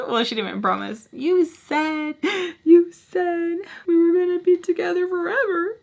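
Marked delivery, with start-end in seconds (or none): 1.1-5.8 mock childish whine. Childish pleading.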